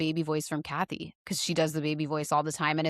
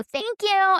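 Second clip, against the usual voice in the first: put-on much higher and louder, a sugary little-girl thank you; girly high thank-you; 14 semitones higher, 6 dB louder than usual. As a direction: chirp it up high like a baby voice. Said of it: "I just set women back forty years".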